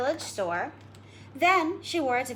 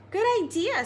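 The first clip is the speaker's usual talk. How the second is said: put-on little girl; 9 semitones higher than usual. Girl voiced.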